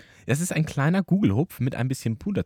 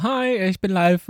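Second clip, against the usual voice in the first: awkward greeting; 11 semitones higher, 5 dB louder, slower than usual. Retold or imitated. Retold awkward self greeting.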